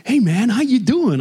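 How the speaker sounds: high, drawn-out chirpy greeting; excitable bank teller. Acted. Teller greeting a reluctant customer, excitable. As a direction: greet like an over-friendly American teller. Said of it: "there's this excitable, um, teller".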